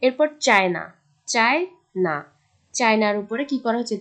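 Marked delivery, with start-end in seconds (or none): 0.5-2.2 China broken in two, loud first syllable, lighter second; stress on first syllable. Shown as a demonstration. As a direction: lean hard on CHAI in China. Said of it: "সিএইচআই এর উপরে স্ট্রেসটা".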